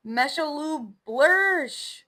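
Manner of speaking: loud high slow gibberish yell; gibberish shout. Pretend. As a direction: bellow the nonsense words.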